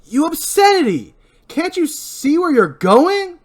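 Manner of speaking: furious high-pitched shout, drawn out; loud, high outburst of outrage; angry yell. Acted. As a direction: yell it at the person who caused the crash. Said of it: "Barry yells".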